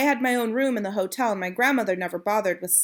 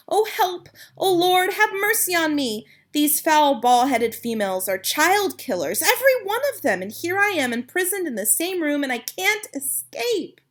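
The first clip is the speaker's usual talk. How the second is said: high, rising panic, pleading to the Lord; terrified child trapped with witches; a frightened boy, trapped and panicking, begs for help; panicked prayer; 8 semitones higher, 4 dB louder than usual. Acted.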